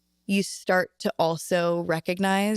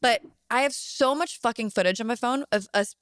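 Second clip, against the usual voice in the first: normal talking voice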